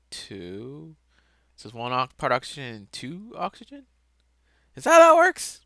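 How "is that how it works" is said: very high, loud questioning yelp; yelled doubt. Acted. Speaker doubting own oxygen sums, high yelled question.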